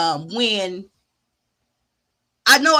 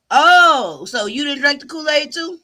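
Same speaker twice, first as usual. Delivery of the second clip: mock surprise; 3 semitones higher, 6 dB louder than usual. Mock question to viewers.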